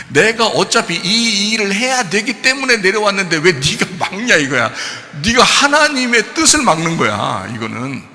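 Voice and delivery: Jesus firmly rebuking Peter; Jesus scolding. Retold or imitated. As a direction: lecture him firmly, with heat.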